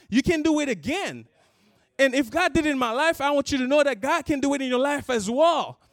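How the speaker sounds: high, loud appeal to the crowd; impassioned preaching. Acted. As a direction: plead with the congregation, full force.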